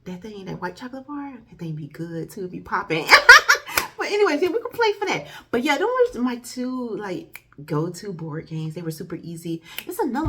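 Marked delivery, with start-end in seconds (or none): 3.1-3.9 big high burst of laughter; loud laugh. Real, not acted. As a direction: let a loud squealing laugh out. Laughs at own joke.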